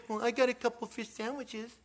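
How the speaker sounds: put-on light, high childlike offer; little kid's voice. A little boy offering his food to the disciples, light high voice. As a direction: offer it like an eager small boy. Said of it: "here comes a little kid".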